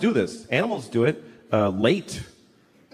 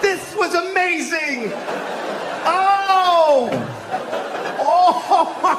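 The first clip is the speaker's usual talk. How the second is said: high, drawn-out hammed-up amazement; amazed friends; 12 semitones higher, much slower than usual. Friends reacting to the apples line, hammed-up amazement. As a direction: whoop and gush way up high, mock awe. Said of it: "And all Matt Damon's friends go".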